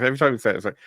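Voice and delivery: usual speaking voice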